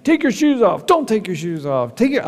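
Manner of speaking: high, barked orders in a mock yell; mock TSA yell. TSA agent barking orders at travelers, mock yell. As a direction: bark orders like an impatient airport guard. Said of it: "they're yelling at you all the time".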